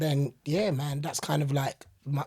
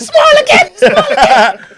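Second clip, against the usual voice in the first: high, loud, laughing Caribbean auntie; 9 semitones higher, 15 dB louder than usual. Retold or imitated. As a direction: coax a smile like a doting auntie.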